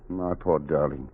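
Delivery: soft, slow and tender; tender comfort. Acted. Husband comforting his wife after her confession, tender. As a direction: console her gently.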